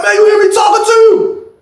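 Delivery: furious high shouting at the man eating his tray; high angry shout. Retold or imitated. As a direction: yell to get his attention. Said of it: "he like".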